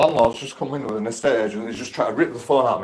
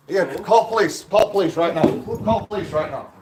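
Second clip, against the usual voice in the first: angry; 7 semitones higher than usual. Real, not acted. Tenant confronting landlord.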